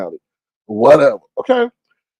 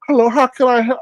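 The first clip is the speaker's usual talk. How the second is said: imitating the clerk; 11 semitones higher than usual. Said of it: "He like".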